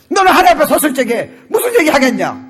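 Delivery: high, forceful questioning; confrontational challenge. Shown as a demonstration. Preacher acting a challenge to an unbeliever, forceful cry. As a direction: press the question like an accusation.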